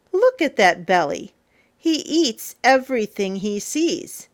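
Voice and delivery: ordinary delivery, nothing marked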